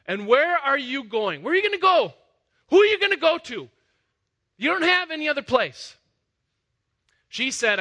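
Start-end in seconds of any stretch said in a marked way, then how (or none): 0.1-6.0 higher and louder, insistent; pressing questions. Acted. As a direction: push each question harder. Angel's questions pressed harder.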